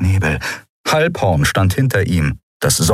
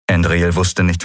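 usual speaking voice

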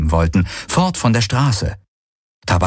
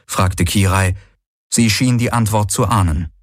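ordinary delivery

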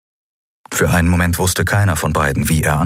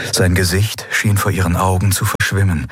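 plain reading voice

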